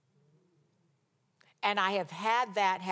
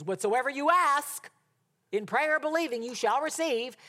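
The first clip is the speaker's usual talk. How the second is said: high, loud quoted declaration; mock scripture proclamation; 6 semitones higher, 4 dB louder than usual. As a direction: declaim the verse in someone else's voice. Quotes a woman's twisted scripture claim.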